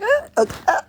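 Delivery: loud, high mock choking cries; pretend heart-attack gasps. Acting out a heart attack, loud high gasps. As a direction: cry out as if faking a heart attack. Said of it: "Heart attack".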